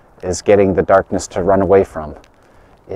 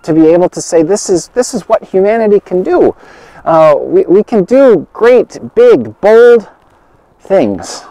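building excitement; 9 semitones higher than usual. Real, not acted. Rising enthusiasm, pitch climbing high.

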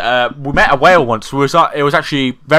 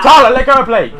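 high, loud yell to stop them; real shout; 9 semitones higher, 4 dB louder than usual. Real, not acted. Kid yelling at wrestling friends, loud high shout. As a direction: shout it across the room to be obeyed.

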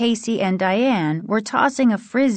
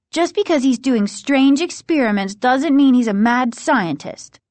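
higher, sharp objection; sharp retort; 6 semitones higher, 4 dB louder than usual. A narrator's reading. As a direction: push back sharply in a friend's face. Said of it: "Margaret said sharply".

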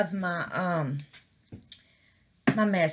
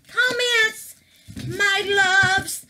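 much higher, louder gleeful cry; excited squeal; 10 semitones higher, 5 dB louder, much slower than usual. Real, not acted. Showing viewers the finished comment pieces, delighted squeal.

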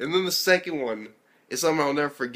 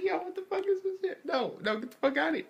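much higher, squeaky shocked outcry; shocked outburst; 3 dB quieter than usual. Retold or imitated. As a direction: recoil in disbelief, voice jumping way up. Reacting to a dance at school.

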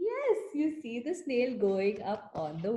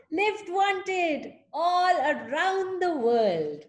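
slow, higher, proclaimed; announcing the sign; 7 semitones higher, 4 dB louder, slower than usual. Acted. Reading the trail the snail wrote, slow proclamation. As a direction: read it like a banner.

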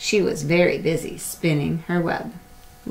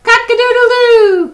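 very high, loud crowing; rooster crow; 13 dB louder than usual. Acted. The rooster crows at the spider, very high and loud. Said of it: "crowed the rooster".

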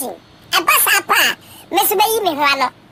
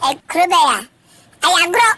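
ordinary delivery

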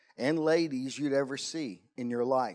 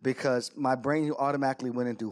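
ordinary talk

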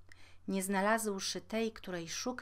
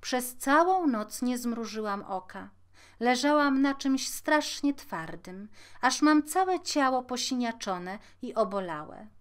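louder, higher storybook princess voice; aggrieved princess, voice raised; complaining princess; 4 semitones higher, 7 dB louder than usual. A narrator's reading. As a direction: complain emphatically about a miserable night. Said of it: "odparła księżniczka".

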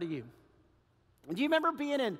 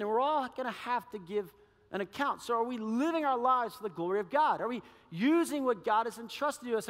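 ordinary talk

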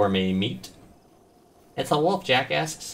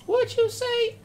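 squeaky voice; slower than usual. Pretend. Mock reaction to own word.

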